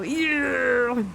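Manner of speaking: hyped rocker squeal imitation; anime fan. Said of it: "это прям типа".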